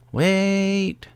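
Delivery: put-on high-pitched valley girl voice; bubbly valley girl, way up high. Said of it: "Like, Valley Girl accent?".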